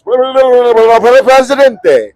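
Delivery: loud, high babble ending in a clear word; mock rapid Spanish. Stranger announces himself to confused tourist, mock rapid Spanish. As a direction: imitate unintelligible Spanish speech-making. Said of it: "he said something in Spanish".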